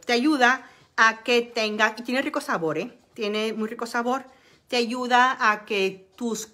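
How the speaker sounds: ordinary talk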